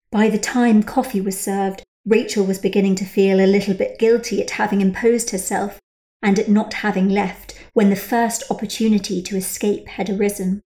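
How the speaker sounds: usual speaking voice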